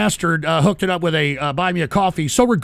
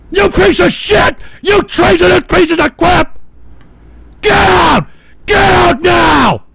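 furious screaming; 7 semitones higher, 6 dB louder than usual. Real, not acted. Host orders draft dodgers out, furious screaming. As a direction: scream them out of the country.

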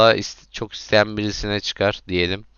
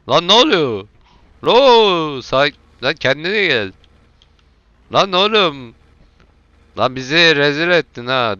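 frustrated yelling; 5 semitones higher, 5 dB louder than usual. Real, not acted. Car flips in game.

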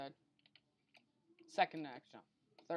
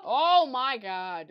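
much louder, much higher, slow drawn-out groan; exasperated yell; 7 semitones higher, 18 dB louder, much slower than usual. Real, not acted. Gamer fed up with his singing mother, loud drawn-out yell.

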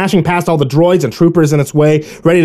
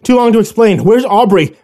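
high, loud and urgent; urgent shout; 8 semitones higher, 5 dB louder than usual. A narrator's reading. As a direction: call it out while running. Urgent question while fleeing.

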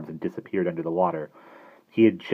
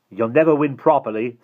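loud, higher defiant call; 6 semitones higher, 7 dB louder than usual. A narrator's reading. Simon calls to Mister Hastings, defiant. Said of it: "Simon called defiantly".

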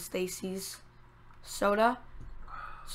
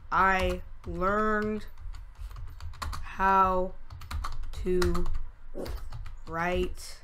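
very slow, long gaps between words; writing along; 4 semitones lower, 7 dB quieter, much slower than usual. Real, not acted. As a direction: dictate to yourself as you write. Speaking along while writing notes.

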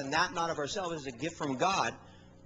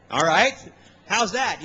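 loud retort; 9 dB louder than usual. Retold or imitated. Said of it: "I said".

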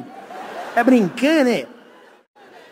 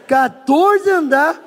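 slow, louder, high-pitched groaned disbelief; mock dismay; 7 semitones higher, 6 dB louder, much slower than usual. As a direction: stretch it out in exaggerated shock. Lamento encenado, muito arrastado. (espanto exagerado, lento e agudo)

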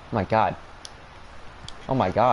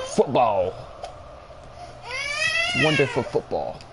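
much higher, hammed-up English accent; mock English accent. Gamer practising his English accent on the football, high and hammy. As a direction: ham up a posh-sounding English accent praising the play. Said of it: "I gotta brush up on my English accent".

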